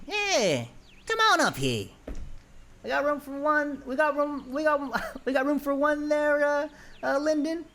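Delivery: very high, goofy welcoming voice; Ron. Acted. Ron welcomes Greeble aboard, very high. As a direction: beam and wave her aboard. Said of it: "And then Ron says".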